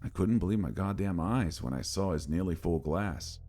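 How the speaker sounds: ordinary delivery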